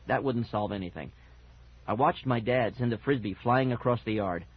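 plain reading voice

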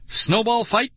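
high, excited shout; gleeful yell, pitch way up. A narrator's reading. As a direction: call it out high like a challenge. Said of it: "she shouted".

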